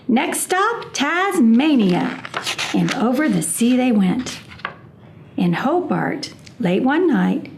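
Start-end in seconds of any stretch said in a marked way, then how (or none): 0.1-2.2 higher, drawn-out slow announcement; announcing the next stop. A narrator's reading. Grandma Poss announcing the trip, high and slow. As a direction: draw out Tasmania slowly with glee. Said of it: "said Grandma Poss".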